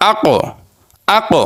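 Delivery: আক with the final ক released in a short echoing bounce; qalqalah bounce. Shown as a demonstration. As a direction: give the ক at the end of আক a small jolt. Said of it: "এই পাঁচটিতে একটু ধাক্কা দিয়ে উচ্চারণ করতে হয়".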